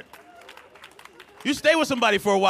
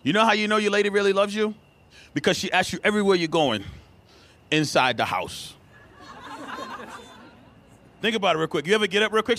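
ordinary talk

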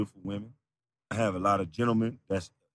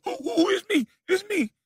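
much higher mocking squeak. Rival parodied, very high mocking squeak.

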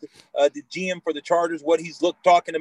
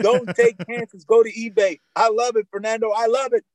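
excited; 5 semitones higher than usual. Real, not acted. Hyped after tagline.

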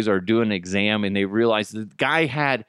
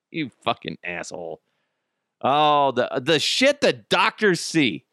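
normal talking voice

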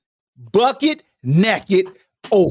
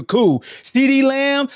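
ordinary talk

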